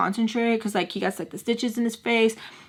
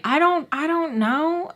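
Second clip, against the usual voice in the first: high, slow flustered reply; 8 semitones higher, slower than usual. Retold or imitated. Caught off guard by question.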